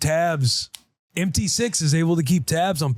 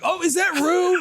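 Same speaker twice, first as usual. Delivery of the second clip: much higher, hammed-up gasp of discovery; mock surprise. Dad hamming up surprise at finding his daughter, much higher. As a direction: act amazed, like finding a hidden child.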